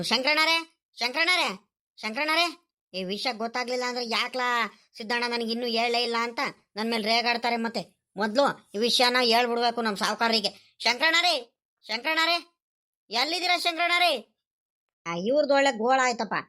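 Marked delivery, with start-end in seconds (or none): 0.0-2.6 raised-pitch call for someone; searching call, drawn up high. Acted. Calling for someone he seeks. 10.8-14.3 high-pitched searching call; name called with lifted pitch. Acted. Searching, calling out his name.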